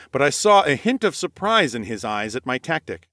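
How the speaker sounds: usual speaking voice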